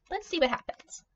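plain reading voice

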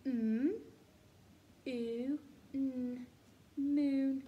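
"M, oo, n" then blended "Moon"; sounding out moon. Shown as a demonstration. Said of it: "Let's sound it out together".

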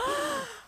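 high, sharp mock gasp; shocked gasp. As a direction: gasp aloud in acted shock. Acted shock at the confession.

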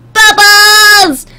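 high, loud drawn-out squeal; excited cartoon shout. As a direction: call it out high and long. Calls out pony's catchphrase.

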